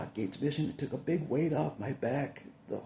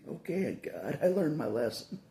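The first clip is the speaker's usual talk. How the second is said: usual speaking voice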